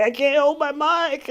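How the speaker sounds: high, loud mock of a shaking addict; mock shaking withdrawal. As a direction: imitate someone with the shakes, hammed-up. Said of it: "come in shaking and shit and be like".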